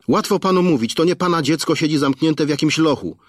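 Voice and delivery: high, fraught wife's voice; reproachful wife. Acted. Wife blaming her husband for calling the police, frantic. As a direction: accuse him in fear for the son.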